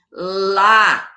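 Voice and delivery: "Lá" alone, low and held long; strong syllable. Shown as a demonstration. Teacher answering her own question, "Lá" stressed and drawn out. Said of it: "Qual que foi a sílaba forte".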